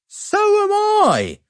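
put-on very high, slow child's protest; indignant cry. A narrator's reading. Barry protests to mum he's grown up. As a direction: cry out it's unfair. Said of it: "I cried".

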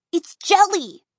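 high, loud delighted reveal, "jelly" falling; giggly. A narrator's reading. Dot gleefully revealing the goo, high loud "jelly". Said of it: "Then she giggled".

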